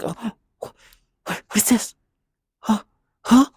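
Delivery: stammering mock bewilderment; pretended confusion. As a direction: play dumb, hammed-up. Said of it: "Try to pretend like".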